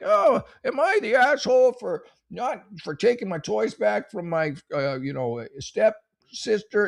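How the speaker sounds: high, drawn-out whine; mock the whiny poster. Mocking a Reddit poster's question, high and whiny. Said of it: "instead of like".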